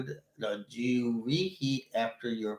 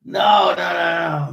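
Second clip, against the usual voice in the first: high, loud anguished cry of no; 8 semitones higher, 15 dB louder, much slower than usual. Real, not acted. Host crying out against the topic, loud anguished no. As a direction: wail it out like a sore loss.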